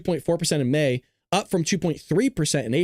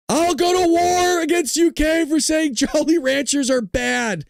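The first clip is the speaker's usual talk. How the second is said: high, drawn-out hammed-up war cry; mock outraged yell; 11 semitones higher, 4 dB louder, slower than usual. Streamer declaring war over Jolly Ranchers, mock outraged yell. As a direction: holler it high and slow in pretend outrage.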